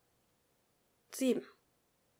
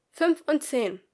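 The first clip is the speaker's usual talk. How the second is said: loud, higher announcement; calling out; 6 semitones higher, 8 dB louder, slower than usual. Acted. Teacher reads out the group numbers, loud. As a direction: read the pair out to the room.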